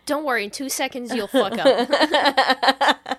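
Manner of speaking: slow, loud, laughing through it; loud, laughing jab at a friend; laughing tease. Real, not acted. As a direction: tease her while cracking up. Teasing her friend mid-laugh.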